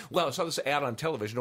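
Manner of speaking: quick, eager customer voice; fast visual customer. Retold or imitated. Salesman imitates a visual customer, talking fast. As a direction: rattle it off like an impulsive buyer. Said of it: "visual people talk really fast".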